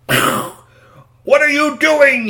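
high, loud, very slow furious yell; angry shopkeeper yell. Acted. Storyteller voicing Mister Tuddy yelling at the boys, high and loud. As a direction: stretch out each word like an outraged old shopkeeper. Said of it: "he yelled".

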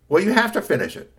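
high, loud scolding mum; insistent mother. Acted. Mother insists son finish homework, insistent. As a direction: lay down the law as mother.

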